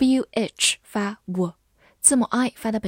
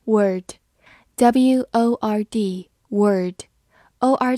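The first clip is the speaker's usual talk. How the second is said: slow spelling; 4 dB louder, much slower than usual. Shown as a demonstration. Teacher spelling a word for students, slow. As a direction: spell each letter slowly. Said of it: "spelling out the word".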